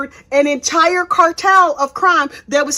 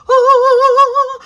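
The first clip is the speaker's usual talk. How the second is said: high, loud delighted squeal; excited squeal; 12 semitones higher, 6 dB louder than usual. Real, not acted. Fan squealing at Kay on the stand, high and loud. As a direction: squeal with delight at seeing her.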